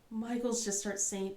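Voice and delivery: usual speaking voice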